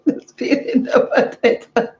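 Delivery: breathy laugh-through words; real laughter while speaking. Real, not acted. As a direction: giggle the words out.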